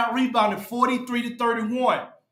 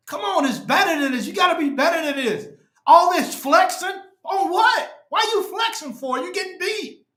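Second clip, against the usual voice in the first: exasperated shout; 6 semitones higher than usual. Real, not acted. Fan ranting at his team.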